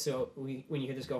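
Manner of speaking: ordinary talk, nothing marked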